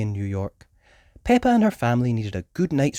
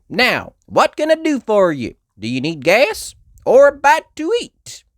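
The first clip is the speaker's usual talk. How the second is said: friendly diner cowgirl; Southern cowgirl; 11 semitones higher, 6 dB louder than usual. Acted.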